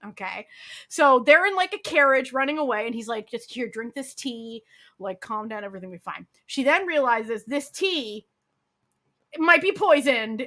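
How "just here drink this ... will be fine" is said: calming reassurance. Retold or imitated. Husband softly soothing his wife in the carriage, quiet and calm. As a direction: lower and softer, falsely soothing.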